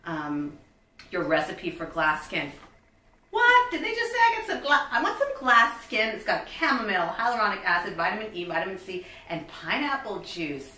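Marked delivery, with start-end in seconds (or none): 3.3-4.9 loud, high startled what; sudden high surprised outburst. Real, not acted. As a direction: caught off guard, exclaim it. Shocked at product text.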